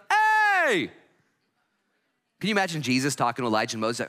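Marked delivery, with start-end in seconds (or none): 0.1-0.9 sudden high, loud yell; Peter yelling. Acted. Peter interrupting Jesus with Elijah and Moses, yelling. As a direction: cut in with a yell. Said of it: "Peter yells out".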